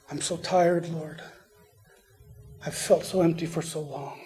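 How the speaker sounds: softly voiced weary confession; understated, spent and soft; retold tearful confession. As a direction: quietly act out a weeping woman's confession. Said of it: "as she's weeping, she is confessing".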